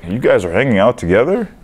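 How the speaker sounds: mock disbelief, pitch climbing at the end; incredulous question. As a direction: imitate people puzzled and surprised. Quoting people surprised at their friendship.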